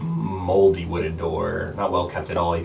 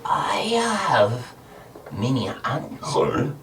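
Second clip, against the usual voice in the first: creature voice; 6 semitones higher, slower than usual. Acted. Creature voice again, choking.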